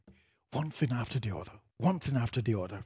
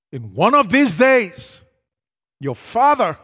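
loud solemn warning; 11 dB louder than usual. Retold or imitated. Pastor's stern rapture warning.

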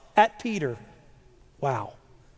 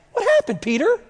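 very high, baffled question; incredulous; 11 semitones higher, faster than usual. Acted. Preacher asking Peter what happened, high-pitched and incredulous. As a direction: squeak it in playful disbelief. Chiding absent Peter in disbelief.

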